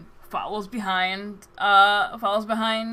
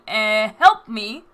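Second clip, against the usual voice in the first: voiced scream for help; 7 dB louder, much slower than usual. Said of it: "You hear Mina's voice scream".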